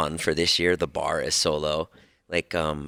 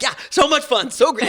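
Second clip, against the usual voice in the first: put-on high squealy kid voice, louder; excited kids; 7 dB louder than usual. Kids gushing about Christmas lights, high and squealy. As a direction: gush like thrilled children. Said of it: "they're like".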